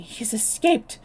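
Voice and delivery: loud, winded report after the chase; out of breath. A narrator's reading. Bill back from hunting the grounds, loud and winded. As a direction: push it out, panting from the hunt. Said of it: "He was out of breath and panting hard".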